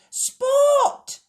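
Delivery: very high, loud cry; Sally seeing the bandaged leg; alarmed cry. A narrator's reading. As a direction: cry out his name in alarm. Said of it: "she cried".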